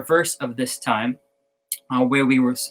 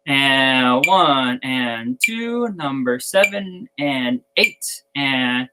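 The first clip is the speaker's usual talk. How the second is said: drawn-out, measured count-off; steady musician's count-in, very slow; much slower than usual. Shown as a demonstration. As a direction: stretch each count to set the tempo. Slow count-in before exercise.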